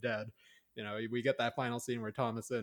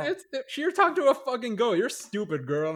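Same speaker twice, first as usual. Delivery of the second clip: much higher, loud jeering voice; mock scolding; 13 semitones higher, 11 dB louder than usual. Viewer mocking Thomasin for talking to a goat, jeering. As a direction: sneer at the girl mockingly. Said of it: "it was just like".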